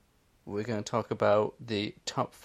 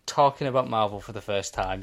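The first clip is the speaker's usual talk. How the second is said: usual speaking voice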